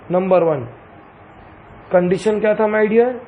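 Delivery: usual speaking voice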